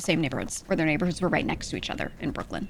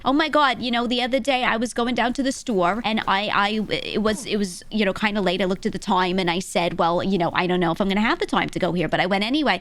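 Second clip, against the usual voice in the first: louder, animated Brooklyn chatter; Brooklyn accent; 2 semitones higher, 3 dB louder than usual. Retold or imitated. Streamer imitating younger Brooklyn locals, thick Brooklyn accent. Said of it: "people that talk like that".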